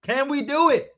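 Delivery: loud, high, drawn-out hype; rally the crowd at the top of your voice; excited streamer shouting a challenge; hyped-up rallying cry. Real, not acted. Rallying viewers toward 400.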